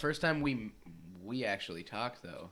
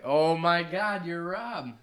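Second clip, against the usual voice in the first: higher, louder, drawn-out amazement; retold amazed recognition; 5 semitones higher, 6 dB louder, slower than usual. Speaker retelling how Dalton recognized his voice at work, mock amazement. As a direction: imitate a friend's stretched-out surprise. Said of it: "And then I did my Australian accent. He's like".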